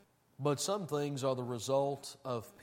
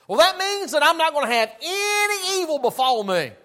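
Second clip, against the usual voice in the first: much higher, louder hammed-up naive voice; mocking naive believer; 15 dB louder than usual. Preacher mocking a naive reader of the verse, hammed-up high voice. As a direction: parody the believer who misreads the verse.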